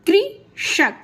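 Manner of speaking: कृषक slowly, split as कृ, षक; slow syllable demo. Shown as a demonstration. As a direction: stretch and separate the syllables of कृषक. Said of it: "उसको slow से बोलेंगे आप". (कृषक धीरे-धीरे, कृ और षक अलग करके)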